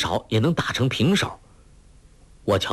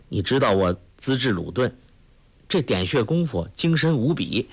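ordinary delivery

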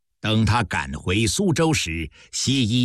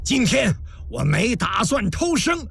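high, strained rallying cry; loud declaration; 11 semitones higher than usual. A narrator's reading. Wounded commander rallying his generals at the critical moment, loud declaration. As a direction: call out defiantly, pitch climbing sharply. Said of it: "大声说".

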